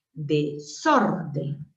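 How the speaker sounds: "desorden" slowly split, syllable by syllable. Shown as a demonstration. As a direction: sound out "desorden" in slow pieces. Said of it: "D y la S va junto".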